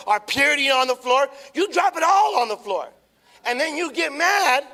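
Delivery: loud, driving preaching with repeated lines; full-force preaching. Acted. Pastor preaching hard to his church, loud. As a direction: hammer each line home to the church. Preaching builds on dropping things.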